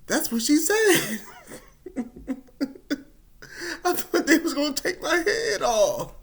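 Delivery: high, laughing that's what she said; giggling excuse; laughing defence. Retold or imitated. Defending himself to family, laughing.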